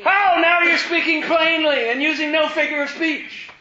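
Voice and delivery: high, loud, gushing mock disciples; mock eager disciples. Mimicking the disciples' eager exclamation.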